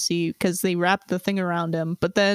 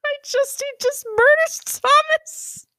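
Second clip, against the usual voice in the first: laughing disbelief. Real, not acted. Reader laughs through outrage.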